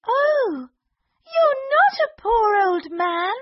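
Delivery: high gasping realisation; shocked princess. Acted. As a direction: cry out in alarm.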